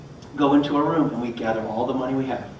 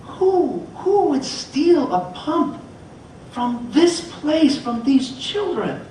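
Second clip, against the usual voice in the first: shocked disbelief; 11 semitones higher, much slower than usual. Retold or imitated. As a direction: cry out in disbelief that anyone would do this. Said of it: "And I was like".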